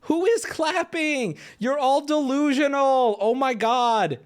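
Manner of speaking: high, slow, incredulous asking; disbelief. Real, not acted. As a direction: drag it out in disbelief. Disbelief at audience clapping.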